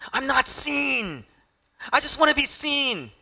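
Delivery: pleading Martha. Acted. Martha's hurt voiced by preacher.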